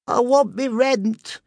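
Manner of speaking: high, drawn-out landlady cry; muffled cry. A narrator's reading. Landlady outside the shut door, high drawn-out cry. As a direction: wail it slowly through a shut door. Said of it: "cried her muffled voice from without".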